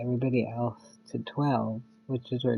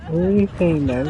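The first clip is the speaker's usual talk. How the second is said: louder, higher indignant demand; 5 semitones higher, 9 dB louder than usual. Real, not acted. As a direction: call out the rude player, exasperated and loud. Indignant at a player refusing.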